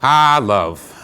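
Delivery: usual speaking voice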